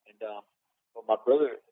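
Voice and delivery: ordinary delivery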